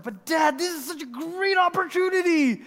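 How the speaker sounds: very high, stretched mock whine; exaggerated pleading son; whiny protest. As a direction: plead like a thwarted kid, pitch soaring. Retold son protesting to dad.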